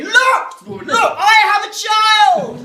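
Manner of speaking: very high, full-volume drawn-out shout; frantic proclamation. Acted. Panicked dad holding child aloft, shouting frantically. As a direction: scream it to onlookers as desperate proof. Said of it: "hold him aloft and I say".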